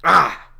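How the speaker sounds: loud, short cry of exasperation; exasperated "Ah!". Acted. Jonah breaking off his rant, loud exasperated cry. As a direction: blurt a loud, acted "Ah!".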